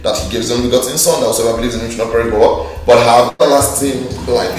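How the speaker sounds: rapid low recitation; fast recital. Shown as a demonstration. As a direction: rattle off the verse from memory. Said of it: "It's just Old English".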